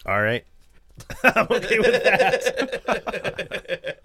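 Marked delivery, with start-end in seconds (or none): 1.2-4.0 said through laughter; laughing. Real, not acted. Laughing at his bad score.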